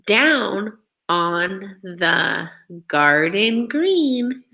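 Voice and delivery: put-on very slow, rhythmic poem read for children. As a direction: read each line slowly with a swinging beat. Teacher reads the poem aloud.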